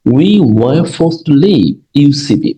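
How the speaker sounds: slow, deliberate reading of the English sentence; teacher dictating the example, very slow. Shown as a demonstration. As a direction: read it out word by word for the learners. Teacher reads example sentence again.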